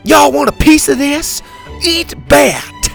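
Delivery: high, loud battle taunt; fierce yell. A narrator's reading. Laney taunting the worms, high loud yell. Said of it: "she'd yell".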